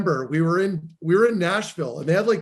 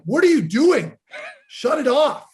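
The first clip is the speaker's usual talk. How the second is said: higher-pitched mock yell at the roommate; retold scream; 8 semitones higher, 3 dB louder than usual. Woken player yells at noisy roommate, retold scream. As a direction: re-enact an outraged yell without full volume. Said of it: "I get out of bed and I'm screaming".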